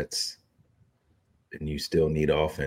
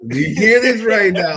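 high mock whine; 13 semitones higher, 10 dB louder than usual. High mocking protest.

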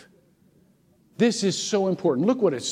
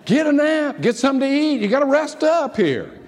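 loud coaxing order; 9 semitones higher, 5 dB louder than usual. Acted. Mock-scolding tired listeners.

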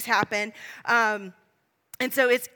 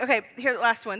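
ordinary delivery

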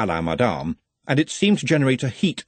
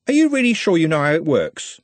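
higher, lightly voiced doubting lad; pitched-up sceptical question; doubtful street lad; 7 semitones higher, 4 dB louder than usual. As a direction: check anxiously that a mate can do it. Trev doubting Nutt.

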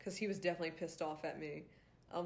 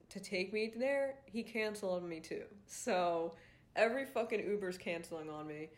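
ordinary talk, nothing marked

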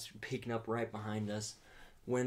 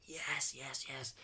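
whispered cheer; 4 semitones higher, 5 dB quieter, much slower than usual. Retold or imitated. Celebrating the hit quietly.

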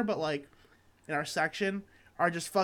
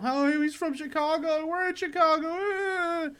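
much higher, whiny mock-fan voice; mocking Chicago fan; 10 semitones higher, slower than usual. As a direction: imitate a gushing hometown fan, half crying. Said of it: "'cause they're all".

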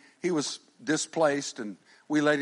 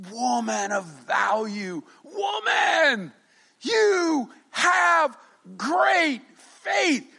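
slow, high ringing shout; awed praise; 12 semitones higher, 6 dB louder, much slower than usual. Acted. Jesus praising the Gentile woman, slow high shouting. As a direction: proclaim her faith like a marvel.

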